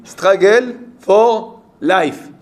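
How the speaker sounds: English in a slow, emphatic French accent; loud-pitched, drawn-out English with a French accent. Real, not acted. Teacher quoting Darwin to students in a French accent. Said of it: "J'ai dit ça avec mon accent français".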